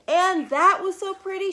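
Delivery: high, loud sing-song gush; delighted refrain. A narrator's reading. Narrator gushing to young listeners, high and delighted. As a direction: gush over how pretty it is.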